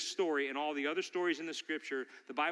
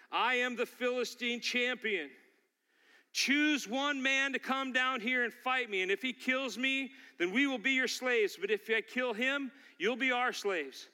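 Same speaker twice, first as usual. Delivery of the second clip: raised, higher challenger's proclamation; crying out; 8 semitones higher than usual. Acted. Philistine giant challenging the enemy army, crying out. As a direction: call out a champion's challenge across a valley. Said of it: "he cries out to the people".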